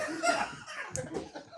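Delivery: a squealing laugh, way up high; high-pitched burst of laughter. Real, not acted. Pastor laughing at his colleague's teasing, high-pitched laugh. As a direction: break into a shrill, delighted laugh.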